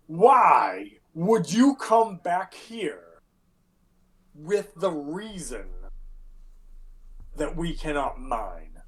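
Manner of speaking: slow, deliberate town speaker demanding answers; measured, weighty questioning of returning hirelings; Oris Matthew. Acted. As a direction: spell it out slowly with long pauses. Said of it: "Oris Matthew".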